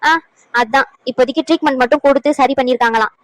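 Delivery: ordinary talk, nothing marked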